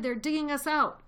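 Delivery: high, loud alarmed shout; panicked father fox shouting to his family. A narrator's reading. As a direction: yell the danger out. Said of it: "he shouted".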